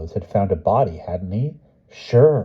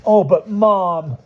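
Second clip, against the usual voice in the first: put-on high, loud, slow child's plea; beg, his world crashing; boy pleading with his mother; 12 semitones higher, 6 dB louder, slower than usual. A narrator's reading.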